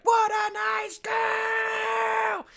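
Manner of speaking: very slow, very high shout trailing off; stretched wail of regret; drawn-out yell. Retold or imitated. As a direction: bawl it down the track as the train leaves. Yelling after train pulls away.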